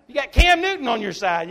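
high, loud preacher's cry to the flock; full-force preaching. Acted. Preacher rousing his congregation, loud and high.

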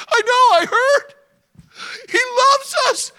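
very high, loud eager father; excited old father. Acted. Father interrupting son Levi, high and loud. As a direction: jump in before he finishes.

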